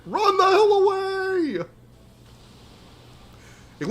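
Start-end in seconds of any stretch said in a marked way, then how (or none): 0.1-1.6 high, drawn-out, frantic yell; panicked yell. Real, not acted. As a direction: stretch it out in panic. Fleeing boss attack.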